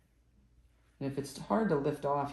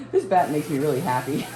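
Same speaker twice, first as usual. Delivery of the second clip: louder, thrilled and beaming; delighted; 3 semitones higher, 10 dB louder than usual. Real, not acted. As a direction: gush about how happy it makes you. Delighted about her fiber bat.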